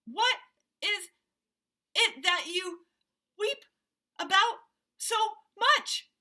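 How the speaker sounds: high, loud gasping question; out of breath. Acted. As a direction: gasp it out while tossed. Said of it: "trying to get his breath".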